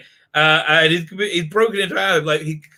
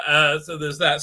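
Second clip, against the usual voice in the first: ordinary delivery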